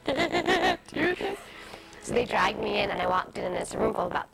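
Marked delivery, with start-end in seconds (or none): 0.1-1.4 high, slow, teary wail of I can't do this; retelling her audition breakdown, replaying the crying voice; imitating her own sobbing. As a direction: relive the breakdown in a mock cry. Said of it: "and I'm sobbing".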